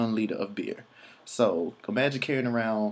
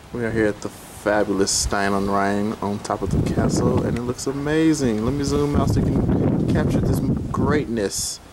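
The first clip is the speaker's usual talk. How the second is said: tour host; 7 dB louder than usual. Acted. On-camera tour presenter.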